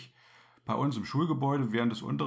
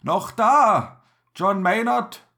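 loud, high call over a fire; shouting through speaking tube; 6 semitones higher, 8 dB louder than usual. Acted. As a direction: bellow it down the tube. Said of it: "durchs Sprachrohr fragt er an".

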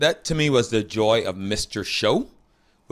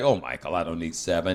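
soft, lower, swooning; mock swooning lover; 6 semitones lower, 4 dB quieter than usual. To the clerk Michael, softer and lower, mock swooning. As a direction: breathe it like a smitten lover. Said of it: "I was like".

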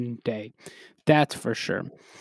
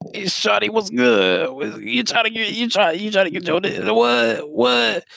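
higher, put-on pickup voice; mock smooth talker; 5 semitones higher than usual. Trying to get with shawty, mock smooth talker. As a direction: lay on a hammed-up catcall.

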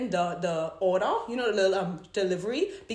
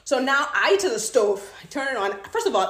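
ordinary delivery

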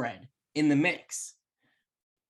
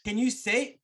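ordinary talk

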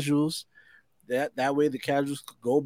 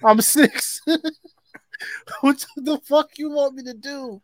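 put-on kid voice; 13 semitones higher, 5 dB louder, slower than usual. Jokingly playing the kid.

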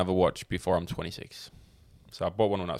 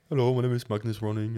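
Brummie accent attempt; 3 semitones higher, faster than usual. Shown as a demonstration. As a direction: try introducing yourself in a Birmingham accent. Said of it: "Give it a try".

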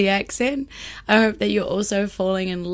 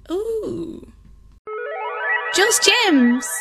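excited; 12 semitones higher, 5 dB louder, much slower than usual. Acted. Playful jingle-like segment intro.

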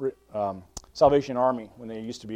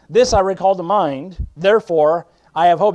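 preaching; 5 semitones higher, 9 dB louder than usual. Acted. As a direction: lean into each word like a revival preacher. Rereads verse with mounting force.

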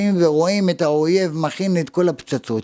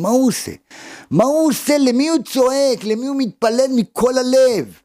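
high, pressing rhetorical questions; impassioned questioning; 7 semitones higher than usual. Real, not acted. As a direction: build the questions urgently, pitched high. Preacher's rhetorical questions build to climax. (שאלות רטוריות נלהבות בקול גבוה)

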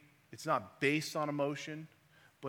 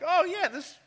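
mocking dismissive voice; 10 dB louder than usual. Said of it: "People think that we read these stories and like".

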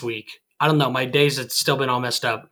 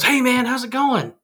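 higher-pitched, lightly voiced friendly stranger; older guy's greeting; 8 semitones higher than usual. Older guy greets him in line at Subway, lightly imitated friendly hello.

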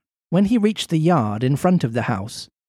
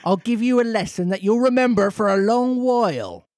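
angry farmer; 6 semitones higher than usual. Acted. Farmer accusing Pinocchio of stealing chickens, angry.

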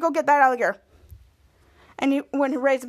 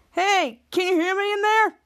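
calling out; 5 semitones higher than usual. Retold or imitated. Man calls into stuck elevator.

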